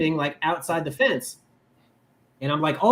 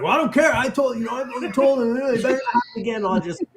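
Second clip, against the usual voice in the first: Andy's dismissive retort; 12 semitones higher, 4 dB louder, faster than usual. Retold or imitated. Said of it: "he's like".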